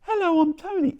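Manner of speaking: high, tiny talking-key voice; Tony, little voice. Acted. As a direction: pipe up like a little gadget calling out its name. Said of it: "and it goes".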